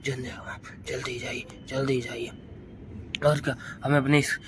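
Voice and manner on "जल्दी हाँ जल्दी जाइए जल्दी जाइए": hushed whisper telling him to hurry; whispered urging. Pretend. Child whispers to the monkey to leave quickly.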